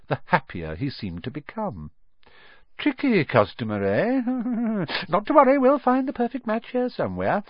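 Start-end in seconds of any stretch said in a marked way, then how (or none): 2.8-7.4 high and delighted with a chuckle; gleeful. Acted. Wandmaker enjoys the hunt for Harry's wand, high and gleeful. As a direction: relish the challenge.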